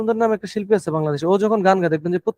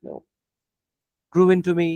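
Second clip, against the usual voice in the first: slow deliberate demo; 2 semitones lower, much slower than usual. Shown as a demonstration. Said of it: "আমার স্পিডটা একটু শুনেন".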